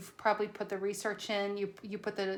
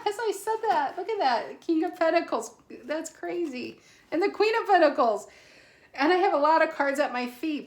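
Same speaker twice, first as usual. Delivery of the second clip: much higher and louder, gushing with amazement; thrilled disbelief; 8 semitones higher, 9 dB louder than usual. Real, not acted. Tarot reader reacting to King and Queen of Pentacles, thrilled shock. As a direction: she can't believe the cards that just jumped out. Stunned by cards that just appeared.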